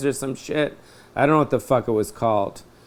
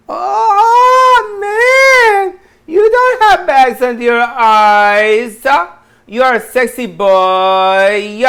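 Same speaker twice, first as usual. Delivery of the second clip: shrill falsetto cry, very high and loud, slow; high mock voice; 10 semitones higher, 7 dB louder, much slower than usual. A squealing falsetto voice flattering himself. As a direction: squeal it out like a cartoon fan.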